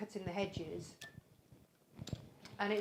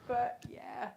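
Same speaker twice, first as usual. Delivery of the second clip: laughing; 9 semitones higher, 12 dB louder than usual. Real, not acted. Laughing about dipped hedge.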